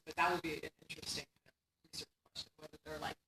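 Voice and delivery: normal talking voice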